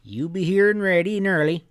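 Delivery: put-on an old storekeeper giving friendly orders; old grandpa voice. Grandpa telling the boy to bring the hounds early, folksy.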